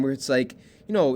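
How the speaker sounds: usual speaking voice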